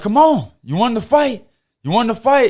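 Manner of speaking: a fighter's high taunting call; taunting yell. Retold or imitated. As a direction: goad him on, chest out. Taunting the kid he just kicked.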